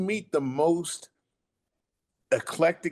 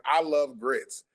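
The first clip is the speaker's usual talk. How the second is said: ordinary talk, nothing marked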